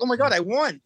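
mildly excited, pitch lifted; retold excitement. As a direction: relive the thrill of winning, lightly. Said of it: "then I got all excited".

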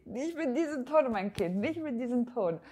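mock-stern mom telling off her child; stern mother. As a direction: snap at the kid like an offended mom. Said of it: "da sagt sie".

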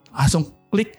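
usual speaking voice

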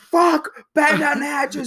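high, loud falsetto alarm; hammed-up cry of alarm; mock panicked shout. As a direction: shriek it like a comic panic. Acting out panicked household seeing them coming.

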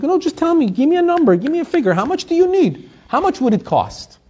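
high, pressing voice urging her to name a figure; insistent old man. Retold or imitated. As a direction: push her gently but firmly for a number, pitched high.

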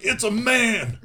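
high, loud comic exclamation; mock astonished shout. Storyteller voicing jet pilots discovering Iron Man, mock high-pitched shout. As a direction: cry it out in exaggerated shock, playing it for laughs.

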